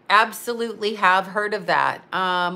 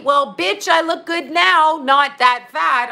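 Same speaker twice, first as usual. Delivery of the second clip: sassy comeback; 6 semitones higher, 4 dB louder, slower than usual. Acted. Talking back to weight critique.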